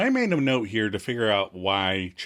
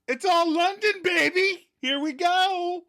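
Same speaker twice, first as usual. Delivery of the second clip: high, whooping mock cheer; excited catchphrase. Recapping Joey setting off for London, excited. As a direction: call it out high, stretching the last words.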